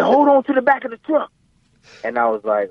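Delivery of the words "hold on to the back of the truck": high, insistent mock-dad command; dad repeating order. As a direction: imitate a father who won't be argued with.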